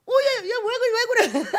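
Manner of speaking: high, loud startled reaction, played for laughs; flustered. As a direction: blurt it out in comic alarm. Flustered reaction to being yelled at.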